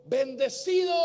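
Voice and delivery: high, loud call into the canyon; father calling out bendecido; shouted blessing. Retold or imitated. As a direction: call out the blessing across the valley. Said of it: "Y le dijo".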